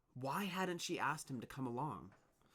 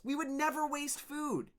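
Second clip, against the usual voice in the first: protest; 6 dB louder than usual. Acted. Worker protests.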